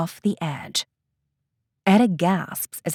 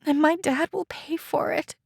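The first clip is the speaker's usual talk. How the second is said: tearful; 9 semitones higher than usual. Acted. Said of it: "her voice breaking as tears begin to well".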